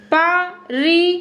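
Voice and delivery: परिवर्तन drawn out slowly syllable by syllable; slow careful pronunciation. Shown as a demonstration. Teacher models परिवर्तन slowly for class. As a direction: sound out परिवर्तन slowly for learners. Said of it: "उच्चारण करो".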